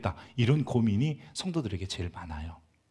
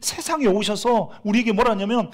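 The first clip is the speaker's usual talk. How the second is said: ordinary talk, nothing marked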